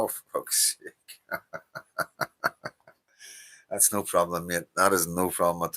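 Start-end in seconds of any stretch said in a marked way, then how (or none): 0.0-1.4 muttered curse. Real, not acted. Reacts to a chat message. 1.4-2.7 low laugh. Real, not acted. Laughs at a chat message.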